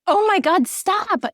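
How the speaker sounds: high-pitched retold exasperation, at normal volume; overwhelmed outcry. Woman recalling her first divorce search, overwhelmed outcry. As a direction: act out being overwhelmed, pitch jumping way up.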